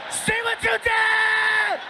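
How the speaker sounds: high, drawn-out wail of blame; mock outraged scream. Son blaming dad after teasing at school, high mock scream. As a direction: shriek the accusation, dragging it out slowly.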